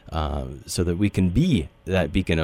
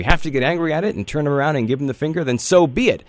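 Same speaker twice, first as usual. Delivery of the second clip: rising to an indignant, faster shout, higher and louder; defiant outburst; 7 semitones higher, 5 dB louder than usual. Real, not acted. Host rallying listeners against fear, defiant loud outburst. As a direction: fire it up, punch through to the end.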